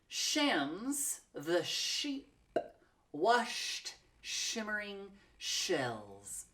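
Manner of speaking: very slow, drawn-out tongue twister; slow demo. Shown as a demonstration. Teacher models the tongue twister for learners, very slow. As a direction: stretch every sh out slowly. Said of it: "let's try a tongue twister".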